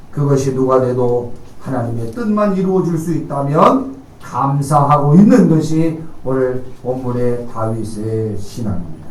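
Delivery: normal talking voice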